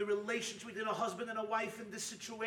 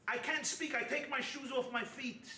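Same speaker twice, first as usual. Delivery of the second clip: ordinary delivery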